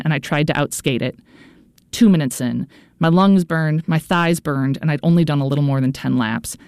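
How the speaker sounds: usual speaking voice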